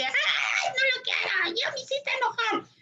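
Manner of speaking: put-on child's tantrum. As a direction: play the angry kid throwing his toy. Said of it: "un niño que avienta su juguete y dice".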